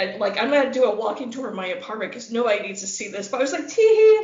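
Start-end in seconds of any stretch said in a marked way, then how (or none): 3.7-4.2 high, loud "tee hee"; mock giggle. Woman retells peeking at her date's bedroom, mock giggling. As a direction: squeal out a put-on titter. Mimics giggling at seeing his room.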